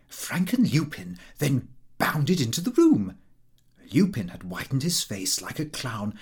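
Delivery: ordinary delivery, nothing marked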